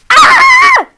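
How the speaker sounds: loud, high shriek of disgust; mother's scream. Acted. Mother peeks at filthy room, shrieking. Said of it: "screamed the mother".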